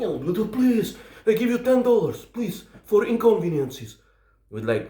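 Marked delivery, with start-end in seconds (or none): 0.5-4.0 high-pitched mock begging; imitated pleading. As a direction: beg for mercy as the frightened passenger. Passenger begs after the threat.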